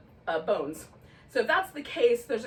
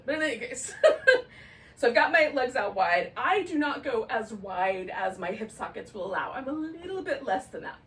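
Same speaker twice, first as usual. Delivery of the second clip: ordinary delivery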